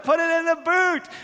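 high, loud silly order; hammed-up command. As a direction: bark it in a playful over-the-top voice. Dad's game instruction.

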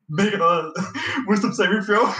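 real laughter breaking into the words; laughing through it. Real, not acted. Storyteller to a friend, cracking up mid-sentence, laughing. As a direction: giggle your way through the phrase, pitch jumping up.

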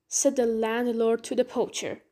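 ordinary delivery, nothing marked

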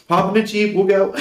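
mom impression. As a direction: play your mom happily agreeing to anything. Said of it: "That'd be my mama".

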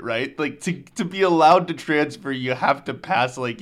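ordinary delivery

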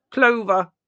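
high, loud dotty aunt; mouth full of toast. A narrator's reading. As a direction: pipe up loudly through a mouthful. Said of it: "through a mouthful of toast".

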